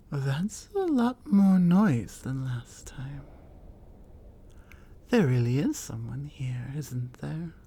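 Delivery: whisper. Acted. Alone in cave, hearing intruder.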